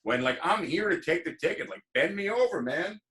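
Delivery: hammed-up taunt to an angry cop; mock sarcastic retort. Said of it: "And I was like".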